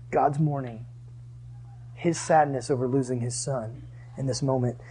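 low, soft, solemn preaching; hushed sorrow. Real, not acted. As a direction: let it sink quietly into mourning. Preacher dwells on God's grief.

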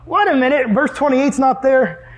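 mock surprise. Acts out finding verse missing.

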